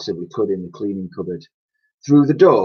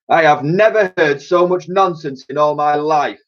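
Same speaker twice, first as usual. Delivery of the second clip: thundering; 7 semitones higher, 4 dB louder than usual. Acted. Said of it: "thundered Clout".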